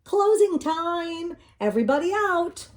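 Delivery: high, loud closing-time call. A narrator's reading. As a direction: shout it across the room. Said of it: "the manager of the laundromat called".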